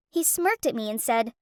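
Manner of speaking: usual speaking voice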